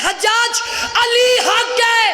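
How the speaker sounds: high, loud cry with stretched-out words; defiant proclamation. Acted. Accused servant declaring his faith to Hajjaj, defiant proclamation. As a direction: hurl it out at full voice. Servant defies the tyrant, acted.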